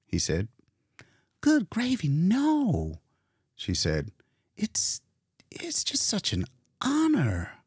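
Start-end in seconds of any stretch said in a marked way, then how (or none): 1.4-7.5 much higher, lightly voiced gushing young woman; mild flustered excitement; Leora flustered.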